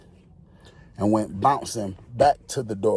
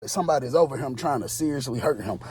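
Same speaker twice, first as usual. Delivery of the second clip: ordinary talk, nothing marked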